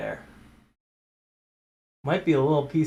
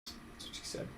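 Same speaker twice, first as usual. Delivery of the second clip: whispered joke; 18 dB quieter than usual. Real, not acted. Joke aside while working.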